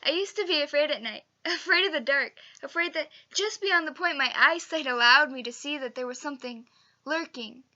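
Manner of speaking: loud, urgent monologue delivery; heightened emotional take. Shown as a demonstration. Drama teacher demonstrating a monologue emotion, loud and intense. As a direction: push it out loudly, rushing into each phrase. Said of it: "in a few different emotions".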